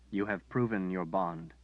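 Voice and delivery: usual speaking voice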